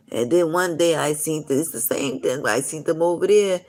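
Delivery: normal talking voice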